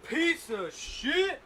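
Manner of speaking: frustrated outburst. Real, not acted. Frustrated at lighter.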